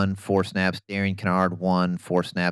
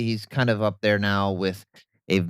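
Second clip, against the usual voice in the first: ordinary delivery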